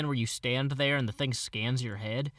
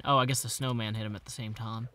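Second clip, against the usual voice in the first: ordinary talk, nothing marked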